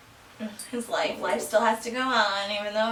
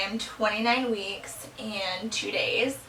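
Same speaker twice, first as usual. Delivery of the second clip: ordinary talk, nothing marked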